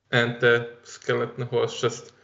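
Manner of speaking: ordinary talk, nothing marked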